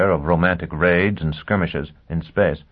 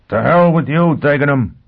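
defiant shout, louder and higher; 7 semitones higher, 6 dB louder than usual. Acted. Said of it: "Foyle shouted".

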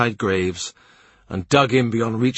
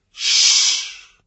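hushing. A narrator's reading. Unseen listener hushes Mark.

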